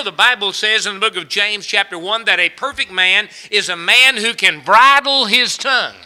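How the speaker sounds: normal talking voice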